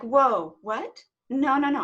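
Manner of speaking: loud startled refusal; loud stunned no, no, no; shocked protest. Retold or imitated. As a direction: push back hard in disbelief. Reacting to shocking inner answer.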